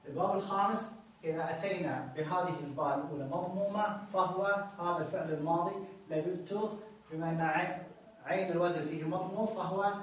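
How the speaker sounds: ordinary talk, nothing marked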